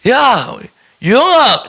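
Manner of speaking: 대구 사투리로 이름 부르기; Daegu accent. Retold or imitated. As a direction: shout the name like a Daegu local. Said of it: "아, 저 대구 사람이네".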